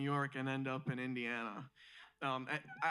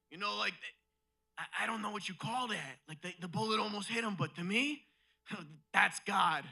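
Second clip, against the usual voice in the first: a slightly raised-pitch impression of a believing dad; lightly voiced dad; 3 semitones higher than usual. Dad to son and wife about the bullet, lightly voiced dad. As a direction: lightly imitate dad explaining a miracle. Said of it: "My dad literally went up to me and my wife, and he goes".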